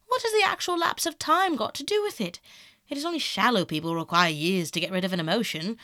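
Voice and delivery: put-on heated, emphatic young man; impassioned self-justification; defiant Dorian. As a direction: argue it with swooping pitch and punched words. Voices Dorian in the quarrel.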